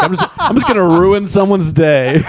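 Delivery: high, put-on gleeful spoiler; hammed-up high voice of a petty critic; mock mean stranger. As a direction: play someone cheerfully out to hurt. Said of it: "You know, they're like".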